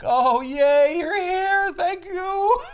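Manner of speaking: high, loud mock delight; gleeful greeting. Old Thanos welcoming Death at the aisle, hammed-up high glee. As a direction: squeal it out, hammed-up and overjoyed.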